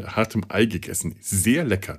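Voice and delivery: ordinary talk, nothing marked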